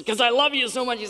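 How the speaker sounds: high, doting, over-affectionate reply; gushing dad. Retold or imitated. Acted dad gushing while hugging.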